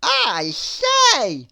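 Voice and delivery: high, loud character voice; piped-up surprise; Mister Nonsense voice. A narrator's reading. Mister Nonsense looking out of the window. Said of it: "he said".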